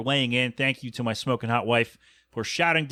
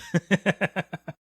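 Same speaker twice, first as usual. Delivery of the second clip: short laugh; 5 semitones higher than usual. Real, not acted. Laughing at his own joke.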